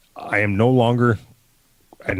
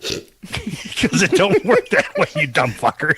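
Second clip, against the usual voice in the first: laughing; 9 semitones higher, slower than usual. Real, not acted. Laughing retort to co-host.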